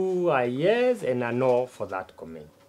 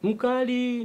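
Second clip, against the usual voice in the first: amused echo; 10 semitones higher than usual. Acted.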